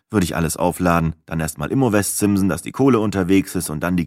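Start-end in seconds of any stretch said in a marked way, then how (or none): none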